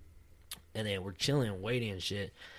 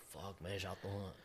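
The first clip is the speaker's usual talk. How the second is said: put-on very quiet, lower drunken mumble; low, hushed groggy complaint; queasy mumble; 5 semitones lower, 19 dB quieter than usual. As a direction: act out feeling sick, barely audible. Drunk self feeling sick in van.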